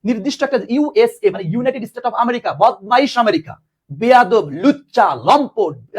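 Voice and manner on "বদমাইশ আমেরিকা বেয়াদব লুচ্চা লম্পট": mock insults. Teacher mock-rants at America.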